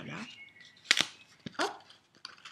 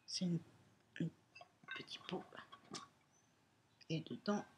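ordinary delivery

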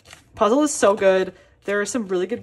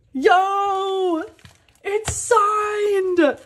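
excited shout; 9 semitones higher, much slower than usual. Real, not acted. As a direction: yell out the discovery. Finds the album is signed.

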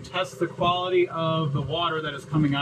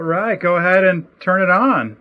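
louder call across the boat to the helper; raised voice, giving the go-ahead; 2 semitones higher, 10 dB louder than usual. Real, not acted. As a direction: call it out so Kate hears you. Calling to assistant to start machine.

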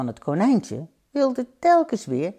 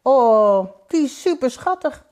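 high-pitched cooing delight; delighted mother; 8 semitones higher, 5 dB louder than usual. Acted. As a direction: gush over something adorable, pitched high. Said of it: "antwoordde zijn moeder". (vertederde moeder, hoge stem)

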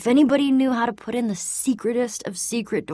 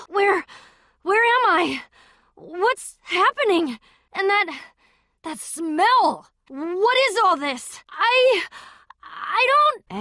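loud, higher, disoriented questions; frantic confusion, louder and higher; bewildered panic; 10 semitones higher, 5 dB louder, slower than usual. Acted. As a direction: fire the questions out, lost and alarmed.

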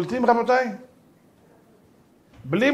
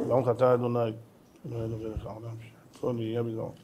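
murmured blessing; 7 semitones lower, 11 dB quieter, faster than usual. Real, not acted. Blessing recited aside.

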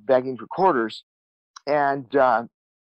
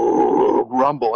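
voiced Harley low-end rumble; imitated engine rumble; 4 dB louder than usual. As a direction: make a long engine-like rumbling noise. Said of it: "like a Harley Davidson low end".

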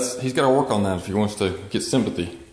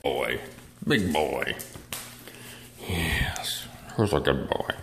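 whispered praise; 7 semitones lower, 6 dB quieter, much slower than usual. Real, not acted. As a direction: whisper to the dog lovingly.

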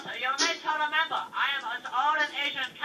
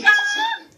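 loud high war whoop; battle cry; 11 dB louder than usual. Retold or imitated. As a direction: yell like throwing a tomahawk. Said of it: "I'm like".

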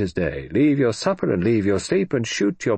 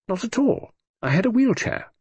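put-on high, airy grand old lady; grand hollywood dame; 13 semitones higher than usual. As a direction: toss it off like a grand dowager. Lady Matilda answering the Admiral.

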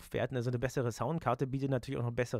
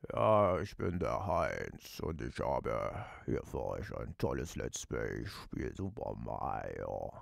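very low, slow, softer deep voice; 4 dB quieter, slower than usual. Shown as a demonstration. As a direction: drop way down like a bass. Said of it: "welche mit tiefen Stimmen".

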